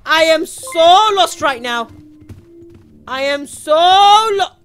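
slow, loud drawn-out wail; lost wail. Real, not acted. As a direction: stretch out the SO in despair. Lost in the game, wailing.

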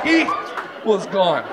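ordinary talk

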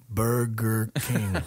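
very slow, syllable by syllable; deliberate, drawn-out joke punchline; slowly. Acted. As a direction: spell it out slowly for a slow listener. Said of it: "say it slowly".

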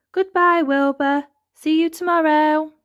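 high, loud cheery call; teasing farewell. A narrator's reading. Elmer pretends to leave Wilbur behind, teasing farewell. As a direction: call a breezy goodbye.

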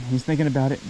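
ordinary delivery

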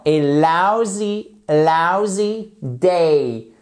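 slow "lousy", OU as in "out"; careful correct pronunciation. Shown as a demonstration. Teacher corrects the French learners' mistake, slow careful pronunciation. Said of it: "ça se prononce exactement comme dans out".

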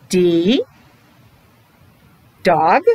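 ordinary delivery

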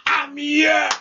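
preaching at full force, chanting and calling out; fervent prayer. Acted. Pastor urging his congregation to pray aloud, preaching at full force.

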